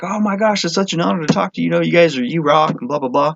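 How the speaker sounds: high, starstruck fan gush. Retold or imitated. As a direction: gush like an honored fan, easing down into blah-blah. Retold gushing to his idol.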